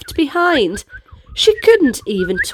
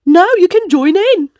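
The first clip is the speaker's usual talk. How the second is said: a bit higher and louder, cheerful; excited tiger; 6 semitones higher, 4 dB louder than usual. Acted. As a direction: call out the plan like an eager tiger. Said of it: "Tiger says".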